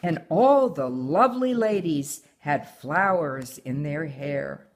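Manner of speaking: put-on reading a picture book aloud to her child; story reading. A narrator's reading. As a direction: tell the tale gently, like a bedtime story. Mother reads story aloud.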